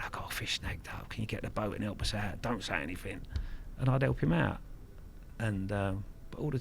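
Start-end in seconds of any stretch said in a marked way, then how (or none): 0.0-3.2 hushed secret request. Retold or imitated. Bailiff sneaking round for help, hushed and much softer.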